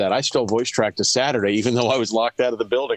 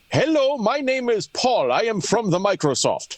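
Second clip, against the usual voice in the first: put-on Indian accent; 8 semitones higher than usual. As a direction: put on a mock Indian scam-caller accent. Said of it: "uh, the Hindi voice".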